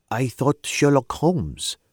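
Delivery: put-on slow, lightly French-accented musing; a touch of French lilt, slow. A narrator's reading. French client naming Holmes to the governor, light French accent. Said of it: "her accent she was from the continent".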